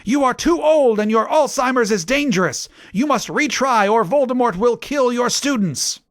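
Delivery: high, hammed-up accusation; theatrical yell. A narrator's reading. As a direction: denounce them in a put-on high voice. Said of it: "yelled Rumbridge".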